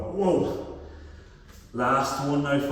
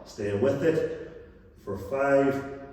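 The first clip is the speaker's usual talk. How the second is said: ordinary talk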